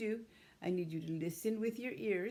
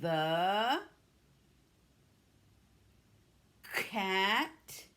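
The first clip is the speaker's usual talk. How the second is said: slow, louder word-by-word model; echo model; 4 dB louder, much slower than usual. Shown as a demonstration. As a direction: say it for the class to echo back. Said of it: "I'm gonna say it one more time".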